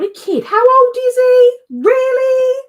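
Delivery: very high, loud squawk of disbelief; loud, high-pitched exasperated disbelief; incredulous outburst. Real, not acted. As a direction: exclaim it, shrill and sing-song. Mock outrage at childish husband.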